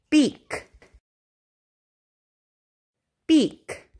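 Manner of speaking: crisp, clearly released final K; over enunciating. Shown as a demonstration. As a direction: model each word clearly for a child. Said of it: "listen carefully and repeat after me".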